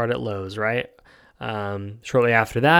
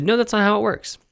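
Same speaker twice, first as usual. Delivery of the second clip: much higher, curt clerk's brush-off; store clerk; 10 semitones higher than usual. Retold or imitated. Retelling the gun store clerk's reply, mock curt. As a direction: play the clerk shutting him down, lightly. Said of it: "They said".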